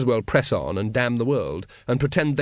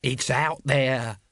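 slow, ominous chant; intoned; much slower than usual. Acted. Nobby warns the watch of the dragon, intoning. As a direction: intone it like a dread prophecy. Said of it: "intoned Nobby".

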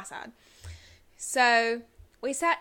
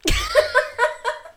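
loud, high giggle burst; embarrassed high laugh; high squealing laugh; 10 dB louder than usual. Real, not acted. Reacts to teasing intro.

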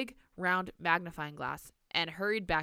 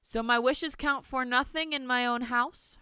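indignant; 7 semitones higher, 4 dB louder than usual. Acted. Grandmother's indignant question.

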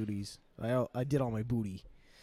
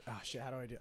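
normal talking voice

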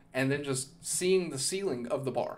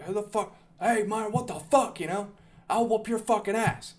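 angry yell; 6 semitones higher than usual. Retold or imitated. Yelling at man who punched him.

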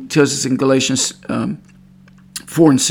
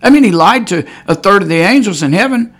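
fervent preaching; 6 semitones higher, 5 dB louder than usual. Real, not acted. Preaching at full force.

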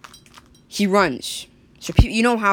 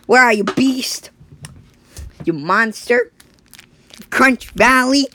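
calling out; 7 semitones higher, 5 dB louder, slower than usual. Acted. Father hunts the beast.

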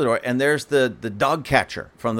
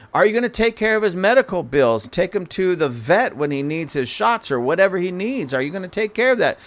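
high, louder, indignant questions; heated retort; 9 semitones higher, 3 dB louder than usual. Retold or imitated. Arguing with the animal control lady, indignant and loud. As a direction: fire each question at her, exasperated.

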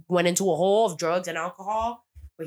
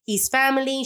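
insistent quote; 7 semitones higher, 5 dB louder than usual. Retold or imitated. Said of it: "she's like".

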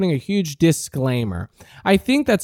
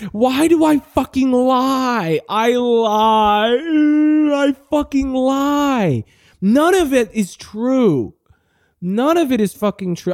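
exasperated rant; 7 semitones higher, slower than usual. Acted. Self-loathing outburst about lying.

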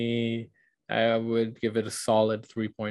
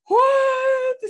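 a shocked what, much higher and louder; 6 dB louder than usual. Retold or imitated. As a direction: shriek it in disbelief. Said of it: "I remember I was like".

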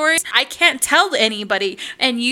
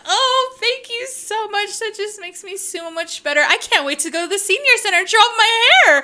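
high, retold gush building louder and faster; delighted gushing; 10 semitones higher, 5 dB louder than usual. Client thanks man for the boost, gushing delight. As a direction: imitate a client thrilled with her hair.

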